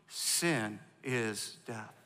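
very slow, word by word; slow call and response. Shown as a demonstration. Pastor leading the church to chant it slowly. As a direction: drag out each word for the congregation to echo. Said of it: "Say it with me".

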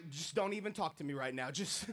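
hangry low grumble, rushed. Retold or imitated. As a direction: mutter it curtly, too hungry for company. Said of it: "I became hangry".

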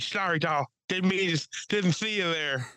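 slurred voice. Retold or imitated. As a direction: slur it like a cartoon. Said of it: "slurred voice".

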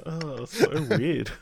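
laughing. Real, not acted. Laughing at odd remark.

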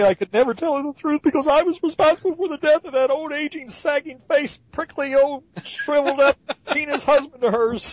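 high, overwrought self-pitying lament; whiny guilt-ridden hero. Retold or imitated. Host parodies Spider-Man's guilt, high whiny melodrama.

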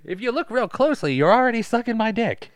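high, loud put-on scout voice; Jonathan Lovett impression. Scout talking down to a young pitcher, in Jonathan Lovett impression. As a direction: crude parody of a cocky scout. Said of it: "You do his voice really good".

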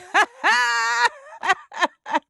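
loud, high, hearty cackle; auntie cackle. Retold or imitated. Imagined Maxine Waters reading the book, auntie cackle. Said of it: "like a good auntie cackle".

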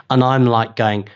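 ordinary talk

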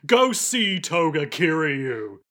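slow, put-on ominous man; Akio voice. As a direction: intone it slowly, like a mysterious villain on the phone. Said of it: "Akio voice goes".

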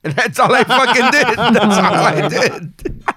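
loud high laughing voice. Real, not acted. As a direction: laugh through the line. Laughing at own drunk story.

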